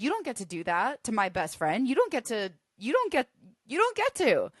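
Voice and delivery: from firm to fierce outrage, escalating; building protective anger. Acted. Telling off whoever mistreats her best friend, escalating fury.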